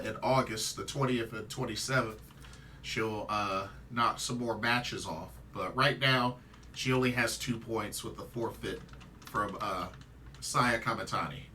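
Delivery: ordinary delivery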